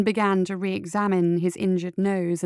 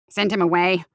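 grumbled; 5 dB louder than usual. A narrator's reading. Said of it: "the guild officer grumbled".

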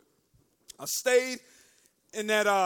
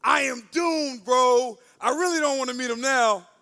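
mock despair; 7 dB louder than usual. Young man dreading God, loud mock despair. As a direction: wail it out loudly to a pal.

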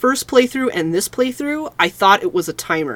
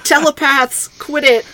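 mock scolding; 9 semitones higher, 3 dB louder than usual.

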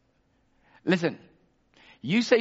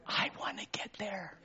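breathy whispered wish; hushed yearning whisper; whispered longing; 6 semitones higher, 12 dB quieter than usual. Real, not acted. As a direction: whisper it as a private hope.

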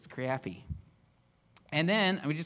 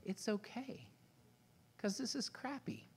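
very soft, higher, confiding reassurance; leaning in gently; 7 semitones higher, 12 dB quieter than usual. Retold or imitated. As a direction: comfort someone close, almost under your breath. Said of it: "he leaned in and said".

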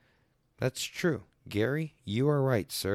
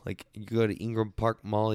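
ordinary delivery

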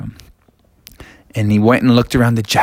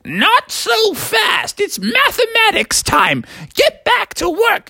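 strict teacher. Acted. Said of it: "And she said".

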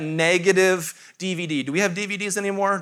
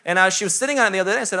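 usual speaking voice

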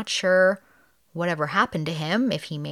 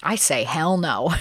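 ordinary delivery

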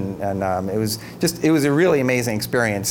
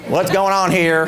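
imitated president; 6 semitones higher, 5 dB louder than usual. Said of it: "and then Bush is like".

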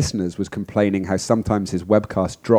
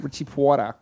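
Richie Porta said the New York way, higher; New York accent; 5 semitones higher than usual. Shown as a demonstration. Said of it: "You said it with, like, what I heard as a New York accent".

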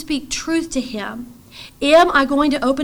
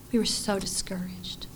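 hushed; 4 semitones lower, 11 dB quieter than usual. Real, not acted. Recalling despair.

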